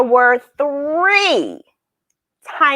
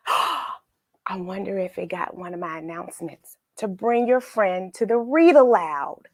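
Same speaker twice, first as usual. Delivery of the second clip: ordinary delivery, nothing marked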